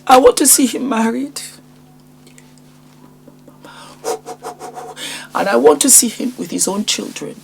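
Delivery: choked up over her son, holding back tears; emotional. Real, not acted. Mother speaking of her son's future, emotional and near tears. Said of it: "sorry if I was a bit emotional".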